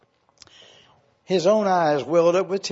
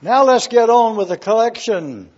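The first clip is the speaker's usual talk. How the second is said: loud, impatient outburst; shouted demand; 5 semitones higher, 6 dB louder than usual. Acted. Man in the second row yells at the auctioneer, loud shout. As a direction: yell it at the auctioneer. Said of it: "The man sitting on the second row shouted".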